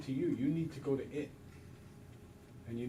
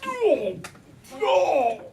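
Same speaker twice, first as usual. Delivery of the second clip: high, loud mock dismay; dismayed parent; 12 semitones higher, 8 dB louder than usual. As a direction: groan like a parent at a dirty diaper. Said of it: "they see their parents like".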